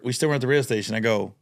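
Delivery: ordinary talk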